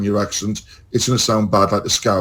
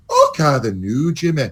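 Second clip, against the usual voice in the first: an easy Scottish lilt; nice rolling Scottish; 3 semitones higher than usual. Shown as a demonstration. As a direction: roll it gently in Scots. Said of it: "one sounds very nice and rolling".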